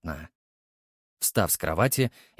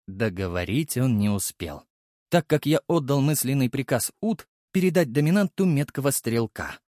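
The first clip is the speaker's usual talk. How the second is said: ordinary delivery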